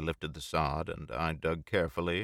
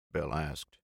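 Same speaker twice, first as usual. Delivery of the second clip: ordinary delivery, nothing marked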